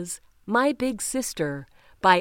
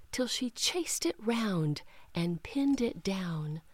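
whisper; 6 dB quieter than usual. A narrator's reading. Narration drops to whisper.

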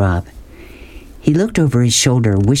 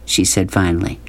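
plain reading voice

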